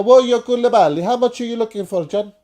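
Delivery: loud, high hammed-up Italian football director; mock Italian accent. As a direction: play a shouty Italian club boss. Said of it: "I sound like Gino here".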